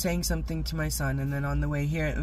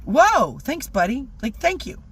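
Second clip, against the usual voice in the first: higher, louder grateful surprise; surprised thanks; 9 semitones higher, 4 dB louder than usual. Retold or imitated. Thanking the cop, surprised and grateful. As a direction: gush thanks at him.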